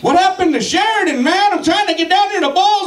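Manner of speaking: high, friendly fake-innocent voice; mock-innocent stoner asking directions; innocent driver. Playing innocent with the cop.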